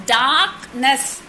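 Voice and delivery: "Darkness" split into dark, ness; two separate beats on "Darkness"; syllable by syllable. Shown as a demonstration. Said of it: "darkness have got two syllable".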